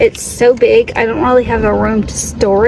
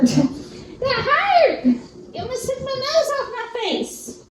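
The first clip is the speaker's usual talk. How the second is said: much higher, softer laughing scold; laughing complaint; 10 semitones higher, 5 dB quieter than usual. Real, not acted. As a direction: laugh off the bump to the dog. Dog hit her nose.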